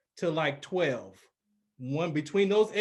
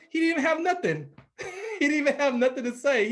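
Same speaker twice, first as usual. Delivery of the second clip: high-pitched words broken by laughter; amused laughing; 8 semitones higher, 4 dB louder, faster than usual. Real, not acted. Son fondly recalling dad had nothing to say, laughing.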